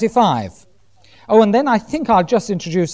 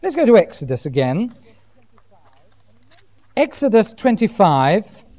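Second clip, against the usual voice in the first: normal talking voice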